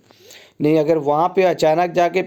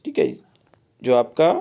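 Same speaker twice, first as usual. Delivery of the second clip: normal talking voice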